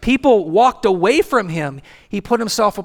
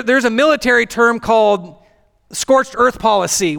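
ordinary talk

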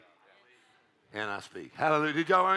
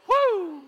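high, loud "woo"; real revival-meeting holler; exultant whoop; 7 dB louder than usual. Real, not acted. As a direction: let out a piercing praise whoop. Preacher whoops in worship.

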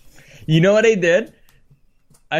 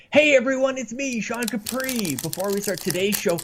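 bright host intro, higher; upbeat podcast host opening; show intro voice; 9 semitones higher than usual. Acted. Intro read retake.

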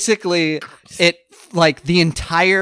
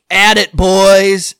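loud, drawn-out foreman's call; mock foreman's work cry; foreman rallying the crew; 5 semitones higher, 9 dB louder, much slower than usual. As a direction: holler it long, as if starting the crew off. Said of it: "the one guy who's the foreman goes".

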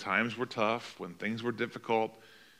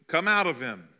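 louder stern order to the spirit; firm, raised rebuke; stern command; 3 semitones higher, 5 dB louder than usual. Acted. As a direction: command the demon firmly. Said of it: "Jesus said sternly".

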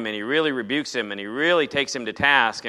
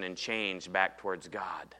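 whisper; 6 semitones lower, 11 dB quieter, slower than usual. Real, not acted. Closing the point on Saul.

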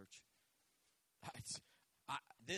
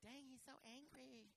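mock listener; 6 semitones higher, 14 dB quieter than usual. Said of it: "I'm like".

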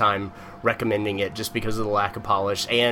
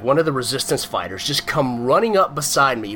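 ordinary talk, nothing marked

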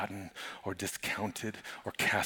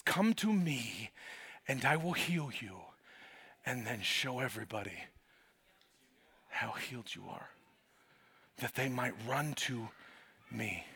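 tender invitation; 7 dB quieter than usual. Acted. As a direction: draw them in almost in a whisper. Jesus' gentle invitation.

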